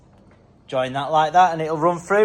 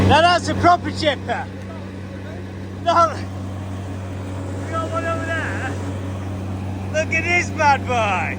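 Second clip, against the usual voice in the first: excited; 12 semitones higher, slower than usual. Real, not acted. Vlogger showing off a proper chipper, excited.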